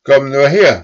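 high, loud furious summons; the oldest cow at her fiercest; angriest cow. A narrator's reading. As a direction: cow calling the boy to account. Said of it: "die allerzornigste".

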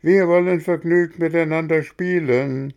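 put-on a cartful of boys yelling together; shouting children. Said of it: "schrien alle aus dem Wagen".

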